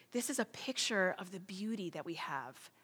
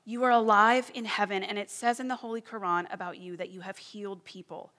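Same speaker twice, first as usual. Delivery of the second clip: louder pleading cry to heaven; 3 semitones higher, 8 dB louder than usual. Retold or imitated. Desperate woman crying out to Jesus, louder plea. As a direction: call out urgently, begging for healing. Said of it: "She starts crying out".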